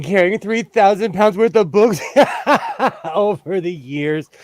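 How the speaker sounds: words broken up by real laughter; giggling through a confession; laughing while talking. Real, not acted. Laughs through her own story.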